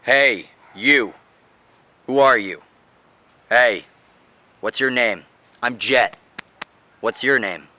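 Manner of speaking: firm, strong, each phrase falling at the end; downward tonality. Shown as a demonstration. Coach demonstrating how to speak, strong downward tonality. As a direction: punch each short phrase, then drop the pitch. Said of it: "downward tonality".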